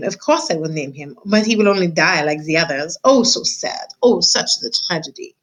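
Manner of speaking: put-on mild French-accented theatrical despair; dramatic French lament. French mother mouse mourns to her husband, hammed-up French lament. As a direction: wail about tragedy in a light French accent. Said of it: "She was a French mouse".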